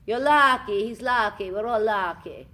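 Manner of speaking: loud, high, hammed-up Transylvanian accent. Shown as a demonstration. Actress proudly demonstrating her Transylvanian voice. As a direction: belt it out in a thick Transylvanian accent. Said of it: "That's my Transylvanian".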